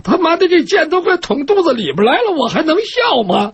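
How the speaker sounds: high-pitched, incredulous complaint; exasperated outcry. Acted. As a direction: protest in a high, worked-up voice. Captive's inner outcry at absurd order. (尖着嗓子叫苦)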